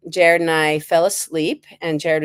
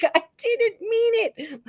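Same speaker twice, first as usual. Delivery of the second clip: high pleading; slower than usual. Retold or imitated. Pleading after dropping baby again.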